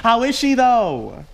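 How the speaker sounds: teasing. Acted. Teasing repeat of question.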